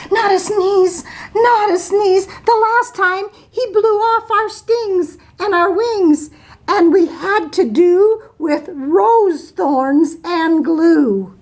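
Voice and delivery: high, anxious bee voices pleading; buzzing bees. Acted. Bees begging the elephant, in high fretful voices. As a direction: bees fretting, pitched high. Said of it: "buzzed the bees".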